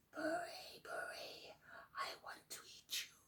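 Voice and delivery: eerie whispered threat; lion's threat. Acted. Lion whispering a threat at old Buri. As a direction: breathe it out in a whisper. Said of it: "he roared".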